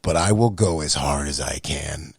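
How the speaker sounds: a loud rising vow to God; fervent vow. Shown as a demonstration. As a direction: cry out the promise with fire. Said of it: "I said it just like this".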